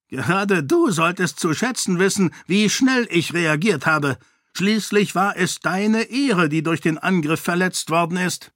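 smug taunt. A narrator's reading. The king condescends to Sarene about her honour, smug.

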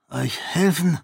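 eine höhere, zögerliche Rückfrage; ungläubig und vorsichtig nachfragen; zögernd. A narrator's reading. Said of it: "fragte Ahan zögernd". (Ahan fragt Sarene zögernd nach)

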